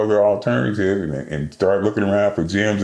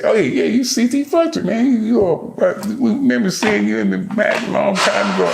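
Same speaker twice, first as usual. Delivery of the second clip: put-on light up like seeing an old legend; excited recognition; 12 semitones higher than usual. Gym staff recognizing an old champion, excited and high.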